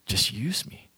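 soft, humble prayer; quiet prayer. Acted. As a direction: hand yourself over to God, softly. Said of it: "and just say".

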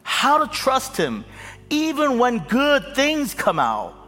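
loud, high rising sermon climax; fervent pulpit crescendo; preaching at full force. Acted. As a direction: build to a shouting preacher's peak.

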